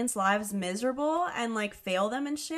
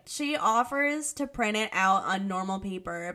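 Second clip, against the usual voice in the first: ordinary talk, nothing marked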